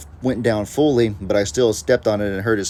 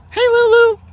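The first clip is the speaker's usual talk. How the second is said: very high squeaky goat call; imitating Lulu's squeak; 7 dB louder than usual. Owner mimicking her goat's high squeal. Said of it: "Lulu has a really distinctive little noise".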